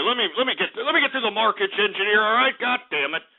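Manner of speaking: ordinary talk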